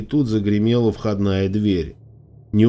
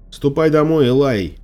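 raised, higher call over the shoulder; louder, higher-pitched parting shout; 6 semitones higher, 4 dB louder than usual. A narrator's reading. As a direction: shout it back to someone behind you. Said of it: "крикнула я через плечо".

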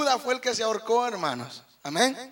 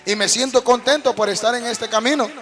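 rising, louder preacher's fervour; fervent preaching; 8 dB louder than usual. Real, not acted. Preacher swelling with joy before the congregation.

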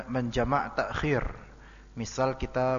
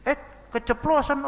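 put-on much higher, louder kid's voice; a child catching himself too late; child blurting out; 13 semitones higher, 5 dB louder than usual. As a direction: act a little kid who let it slip.

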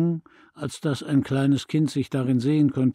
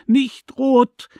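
high, loud shout through sobs; a child screaming it out in tears; furious child's cry, voice breaking; sobbing angry shout; 11 semitones higher, 8 dB louder than usual. A narrator's reading. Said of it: "schrie ich zwischen wütenden Schluchzern".

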